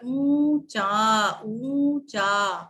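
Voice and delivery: "ऊंचा" stretched slowly as "oon-chaa"; very slow "ऊंचा", both vowels long; long nasal U. Shown as a demonstration. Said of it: "तो ये बड़ा ऊ".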